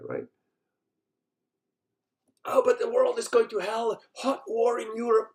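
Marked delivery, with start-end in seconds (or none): 2.5-5.3 mock worrier. Mocking doom-worried people.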